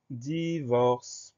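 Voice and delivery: DIVORCE drawn out slowly, each syllable held. Shown as a demonstration. Teacher dictates the next word to pupils, syllable by syllable. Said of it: "Première syllabe".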